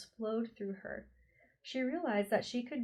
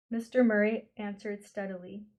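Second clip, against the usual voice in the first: ordinary delivery, nothing marked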